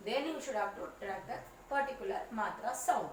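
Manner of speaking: ordinary talk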